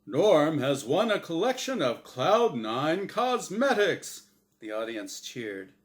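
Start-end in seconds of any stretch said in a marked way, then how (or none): 0.1-4.2 loud prize-reveal announcer voice. Acted. Unseen announcer revealing the consolation prize, announcer voice. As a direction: read the prize like an ad. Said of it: "a deep-voiced announcer said".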